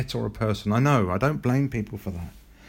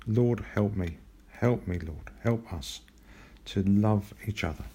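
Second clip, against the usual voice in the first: quiet prayer; 2 semitones lower, 4 dB quieter than usual. Acted. Closing prayer.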